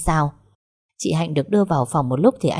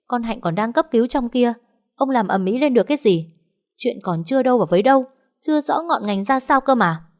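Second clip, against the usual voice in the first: high-pitched, exasperated reproach; indignant scolding; 6 semitones higher than usual. A narrator's reading. Mother tells husband not to make a scene, scolding indignantly. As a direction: remonstrate in a high, urgent pitch.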